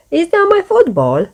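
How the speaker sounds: a high, startled question; surprised question. A narrator's reading. Bob spotting his football in the basket, surprised. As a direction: sound caught off guard. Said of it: "asks Bob".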